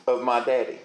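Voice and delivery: normal talking voice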